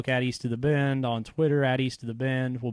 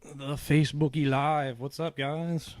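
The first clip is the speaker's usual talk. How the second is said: hoarse, smoker's rasp; worn-out raspy voice, just talking; 4 semitones higher, slower than usual. Real, not acted. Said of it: "Sound like a smoker".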